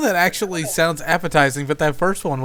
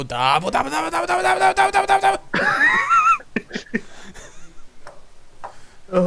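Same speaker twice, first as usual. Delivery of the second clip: high shouted rapid da bo chant; JoJo battle cry; much faster than usual. Pretend. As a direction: yell it like an anime rush attack. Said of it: "go all JoJo reference".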